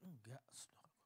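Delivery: a soft whispered no, gently correcting; sudden whisper. Real, not acted. Preacher whispering to the congregation, gently denying it. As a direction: drop right down to a breathy whisper. Preacher answers his own example.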